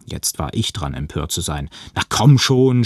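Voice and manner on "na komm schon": loud, higher-pitched outburst; indignant shout. A narrator's reading. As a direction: shout it out, fed up. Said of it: "schrie ich". (empörter Aufschrei)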